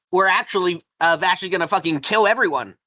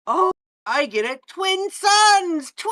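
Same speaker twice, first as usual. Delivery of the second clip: excited shout; slower than usual. Real, not acted. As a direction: shout it out in delight.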